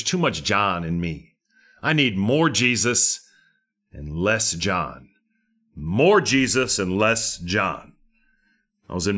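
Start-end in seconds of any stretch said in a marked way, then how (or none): 1.8-7.9 slow emphasis. Real, not acted.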